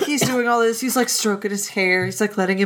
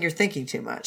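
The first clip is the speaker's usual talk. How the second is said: lower, softer, flat deadpan; low curt brush-off; 8 semitones lower, 9 dB quieter than usual. Retold or imitated. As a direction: shut him down quietly and dry. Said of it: "He's just saying".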